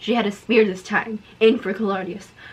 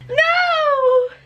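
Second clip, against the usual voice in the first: high hammed-up cry of no; mock shout; 5 dB louder than usual. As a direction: wail it like a melodrama. Heroine's cry read aloud.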